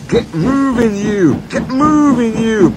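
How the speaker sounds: high, loud, effortful urging; puffing. A narrator's reading. Douglas urging trucks from behind, loud and puffing. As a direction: shove each phrase out like a puffing engine. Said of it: "puffed Douglas from behind".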